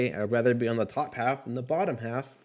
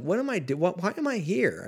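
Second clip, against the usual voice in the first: mock bewildered; 8 semitones higher than usual. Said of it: "I'm like".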